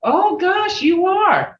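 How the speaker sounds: high, rising oh gosh of amazement; amazed exclamation. Retold or imitated. Elder admiring what you show, amazed and high. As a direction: gush over it like a delighted elder. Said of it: "they'd say".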